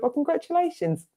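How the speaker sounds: high, gushing little "congratulations". Acted. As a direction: coo it like a delighted old lady. Voices the spirit lady's congratulations.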